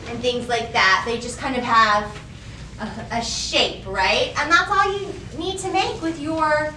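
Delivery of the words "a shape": ordinary delivery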